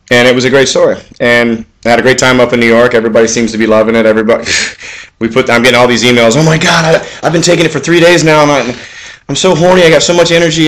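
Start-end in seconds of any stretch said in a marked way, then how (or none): 6.4-10.7 higher, gushing mock customer; excited customer. Reading fan emails aloud, hammed-up excited customer. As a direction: imitate an excited buyer, pitched up.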